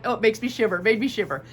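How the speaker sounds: normal talking voice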